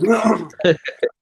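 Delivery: short amused laugh; a quick real laugh. Real, not acted. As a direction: laugh at the mate's answer. Laughs at the reply.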